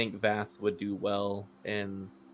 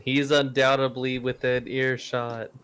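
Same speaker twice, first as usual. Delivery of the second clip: projected, louder announcement; saying out loud; 2 semitones higher, 7 dB louder than usual. Acted. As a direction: declare it out loud to the sky. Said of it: "saying out loud".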